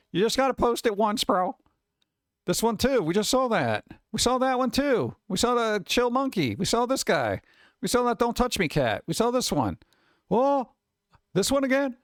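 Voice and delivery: fed-up, high-pitched griping; exasperated. Real, not acted. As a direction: complain about seeing the same thing over and over. Complains about repeated posts in feed.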